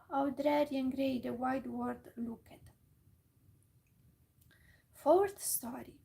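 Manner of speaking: ordinary delivery, nothing marked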